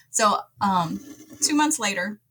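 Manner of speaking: normal talking voice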